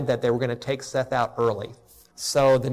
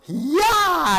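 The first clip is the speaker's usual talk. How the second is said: high-pitched imitated newborn cry; mock baby yell; 13 semitones higher, 4 dB louder than usual. Pastor imitates his newborn son's first yell, high mock cry.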